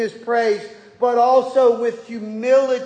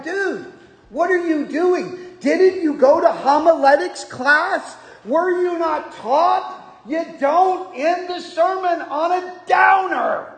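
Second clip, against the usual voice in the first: higher, rising into a shout at the end; exasperated mock rebuke; 6 semitones higher than usual. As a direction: scold the psalmist like a buddy, building up. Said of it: "I'm like".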